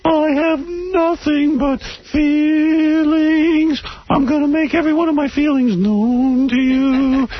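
high, slow nasal whine; nasal whiny voice. Retold or imitated. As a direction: drag every word out nasally. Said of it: "In a very nasal voice".

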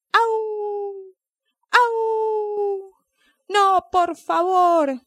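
high wailing yelps, then a slow, high sobbing plea; dog crying. Acted. Scared dog sobbing to the snail, high and slow. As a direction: howl and beg through tears, drawn out. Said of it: "el perro comenzó a llorar más y más fuerte".

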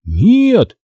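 high cry of denial; a narrator's mild high shout. A narrator's reading. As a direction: cry out in protest, pitch jumping up. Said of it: "закричал я".

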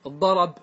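الضرب said with fatha moved onto the ra, al-ḍarab; teacher models الضرب as al-ḍarab; فتحة on the ra. Shown as a demonstration. Teacher demonstrates pausal الضرب with fatha on the ra. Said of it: "نقلت الفتحة".

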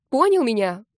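loud, insistent demand; still agitated. A narrator's reading. Heroine pressing him to answer, loud and agitated. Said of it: "Не могла успокоиться я".

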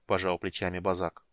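usual speaking voice

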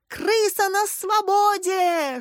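high, drawn-out alarm yell. A narrator's reading. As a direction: wail the alarm slowly, high-pitched. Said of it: "что есть мочи завопил". (протяжный вопль во весь голос)

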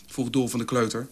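ordinary delivery, nothing marked

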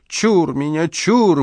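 operatic wail, climbing high on the last "чур"; [name]-like cry. A narrator's reading. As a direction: ham it up like a bass in an opera. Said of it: "с шаляпинскими интонациями в голосе". (шаляпинский возглас)

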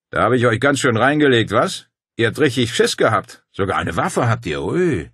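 laute, vergnügte Männerfigur; diebische Freude. A narrator's reading. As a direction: sich wie ein Schneekönig über den Streich freuen. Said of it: "Er freute sich wie ein Schneekönig". (hämisch vergnügt)

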